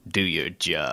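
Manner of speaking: low, menacing imitation of an overdrawn order; Daniels' low, foreboding line. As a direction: drop the voice low and hammy. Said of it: "as low and as foreboding".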